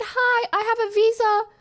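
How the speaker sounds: high, sweet explaining; overly polite high voice. Retold or imitated. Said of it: "I said".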